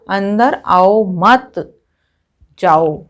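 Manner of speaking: "अंदर आओ मत" run together, then a break before जाओ; group मत with आओ, pause, then say जाओ; pause after मत. Shown as a demonstration. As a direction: hold off जाओ until after a clear pause. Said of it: "हम अगर यहाँ पर विराम चिन्ह लगाते हैं".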